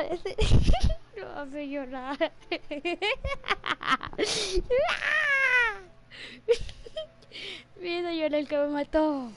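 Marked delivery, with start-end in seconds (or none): 0.4-1.1 sudden high, loud laugh; burst of laughter. Real, not acted. Streamer bursting into loud laughter at teammate. 2.4-7.1 laughing so hard it turns to crying; wheezing, high, tearful laughter; long helpless laugh. Real, not acted. Streamer laughing to tears after being killed, helpless laugh.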